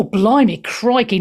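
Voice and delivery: ordinary delivery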